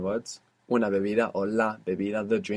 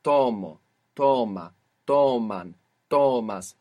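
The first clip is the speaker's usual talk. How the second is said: "tomo, toma, toman, tomas", each hit on TO, then falling; stress on first syllable; 5 dB louder, slower than usual. Shown as a demonstration. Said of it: "the accent's moving".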